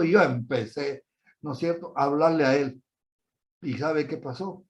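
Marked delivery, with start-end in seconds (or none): none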